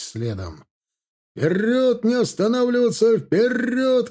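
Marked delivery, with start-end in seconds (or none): 1.3-4.1 high, drawn battle command; officer's rallying yell, pitched up; commanding shout. A narrator's reading. Officers driving their units forward, commanding shout. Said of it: "командовали офицеры".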